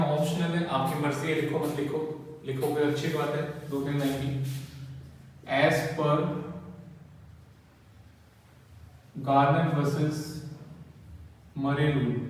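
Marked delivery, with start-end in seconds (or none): 5.5-12.2 very slow, word-by-word dictation with long pauses. Acted. Teacher dictates the theory answer to students, very slow. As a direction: dictate each word so the class can write it down.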